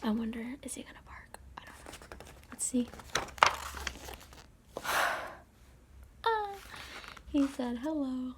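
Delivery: plain reading voice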